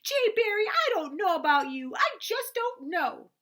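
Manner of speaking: very high, loud tearful wail. A narrator's reading. Sister wailing at Jay Barry over the lost goose, very high loud wail. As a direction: keep wailing, slowing at the end. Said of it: "Daisy wailed".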